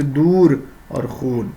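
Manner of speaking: دور and خون with a slow, stretched oo: "door", "khoon"; drawn-out long u. Shown as a demonstration. Teacher demonstrating waw maroof, slow long oo in دور and خون. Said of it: "واو جو کھینچ کر پڑھا جائے".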